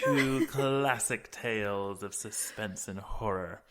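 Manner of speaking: lightly spooky storyteller voice. Acted. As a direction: announce it like a hammy horror host. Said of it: "You're doing your spooky voice again".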